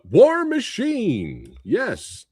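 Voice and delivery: hyped announcer. Acted. Hyping the next movie.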